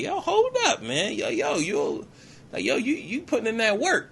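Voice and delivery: high-pitched, impressed double take; imitating an astonished woman; mock amazement. She is amazed at his skill.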